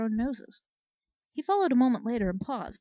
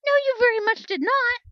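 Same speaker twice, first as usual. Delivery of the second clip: much higher and louder, indignant; exasperated outburst; 11 dB louder than usual. Acted. Flare scolding Shining Mind for never mentioning it, loud and indignant. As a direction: blow up at her in disbelief.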